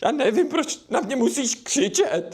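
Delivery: the son whimpering back after being yelled at; mock crying. Scolded dad mock sobbing. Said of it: "Proč brečíš?".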